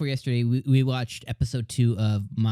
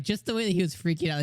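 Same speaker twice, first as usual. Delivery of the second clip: normal talking voice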